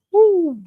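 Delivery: high whooping woo; excited woo. Real, not acted. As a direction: let out a loud happy woo. Exclaims about the burgers.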